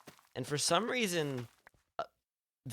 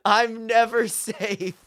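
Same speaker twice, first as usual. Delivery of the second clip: loud, high, drawn-out lament; mock despair; 9 semitones higher, 7 dB louder, much slower than usual. Real, not acted. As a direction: wail slowly as if hopelessly hunted. Mock despair at being caught again.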